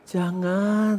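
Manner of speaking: soft, drawn-out gentle plea; hushed gentle demonstration. Shown as a demonstration. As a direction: say it very softly and tenderly. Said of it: "nah itu lembut kan".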